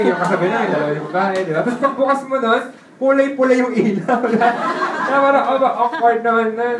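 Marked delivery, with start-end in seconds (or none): 1.6-6.8 higher and amused, laughing as he tells it; laughing through it. Real, not acted. Telling listeners about the red-lit Sogo room, laughing. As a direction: giggle through the awkward bit.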